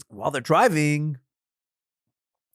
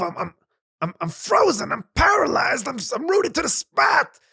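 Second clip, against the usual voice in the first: rising alarm, higher and louder by the end; panicked landowner; 8 semitones higher, 4 dB louder than usual. Acted. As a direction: discover you can't move and panic. Landowner finds himself frozen.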